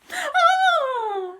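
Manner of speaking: sudden high shriek of outrage; Mum's scream. Acted. Mum shrieks at Henry over the flower bed, high shriek. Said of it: "she screamed".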